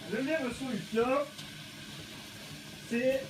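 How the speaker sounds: speaking through a ski mask, muffled. Real, not acted. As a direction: talk from inside a goggle mask. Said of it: "mettre un masque pour éviter les projections".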